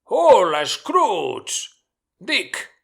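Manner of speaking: mucho más fuerte y agudo, alegre; llama a los aprendices con voz poderosa; llamada jovial y sonora. A narrator's reading. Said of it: "llamó con poderoso, sonoro, rico y jovial acento". (Fezziwig llama a sus aprendices, jovial y sonoro)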